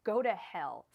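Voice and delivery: angry outburst. Real, not acted. Tarot reader cursing whoever invented twin flames, angry outburst. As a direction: rant that builds to a loud curse. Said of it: "I'm not yelling at you".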